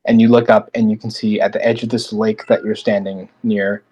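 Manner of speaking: normal talking voice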